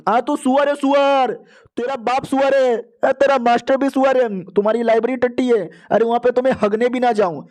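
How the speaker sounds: high-pitched hurled insults; yelling insults. A narrator's reading. Angry man slandering a rival and his master, yelling insults. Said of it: "चिल्ला चिल्ला के इतनी बदनामी करता है".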